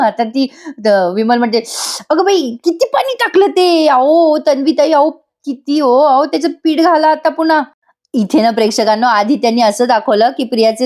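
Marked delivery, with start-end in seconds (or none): 2.1-7.6 high, fussing maid voice; flustered maid. Retold or imitated. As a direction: fret over the spilled water like an old maid. Maid Vimal scolds flustered. (घाबरलेल्या मोलकरणीचा उंच आवाज)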